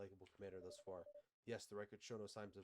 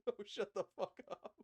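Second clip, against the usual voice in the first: a high, loud laughing shout; laughing yell; 12 semitones higher, 6 dB louder than usual. Real, not acted. Player laughing at his own blunder, high loud laughing yell. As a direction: blurt it out through laughter at the judge.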